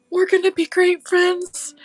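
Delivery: up high and brighter, cooing at the gnome; high excited cooing. Pretend. Crafter greets her new gnome in a high coo. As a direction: gush at him like a baby.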